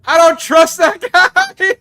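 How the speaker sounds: high, loud panicked shout; yelling while being chased. Real, not acted.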